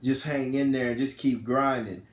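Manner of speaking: acted-out hushed comfort; retold tearful reassurance. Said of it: "and she's crying, and she's like".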